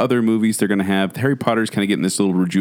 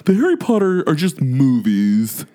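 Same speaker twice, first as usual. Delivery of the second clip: slow, exaggerated snob about films; mocking impression; 4 semitones higher, slower than usual. Co-host mimicking his friend's taste in films, mocking and drawn out. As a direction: draw it out, mimicking a film purist. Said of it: "Not in that exact tone".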